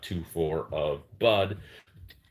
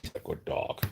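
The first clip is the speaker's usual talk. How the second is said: whispering; 7 dB quieter than usual. Real, not acted. Quiet remark about dog.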